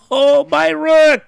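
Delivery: high, loud, slow wail; wailing my rook. Real, not acted. Streamer wailing over his lost rook, loud and slow. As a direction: stretch it out in real anguish. Losing rook in chess game.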